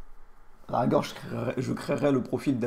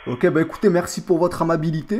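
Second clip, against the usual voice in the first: loud, higher mock rebeu French accent; put-on rebeu accent; 5 semitones higher, 7 dB louder than usual. Prank caller thanking the worker for their kindness, put-on rebeu accent. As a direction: thank them loudly in a fake rebeu accent. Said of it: "de la racaille qui lâche un merci".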